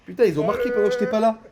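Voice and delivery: high, fast startled outburst; surprised exclamation. Real, not acted. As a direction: blurt it out in disbelief. Missed goal while away.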